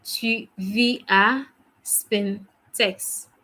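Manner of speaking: Tu vis à Spintex, word by word, very slowly; very slow repeat. Shown as a demonstration. Teacher repeating the sentence for students, very slowly. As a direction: lay out every syllable. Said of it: "Ça va?".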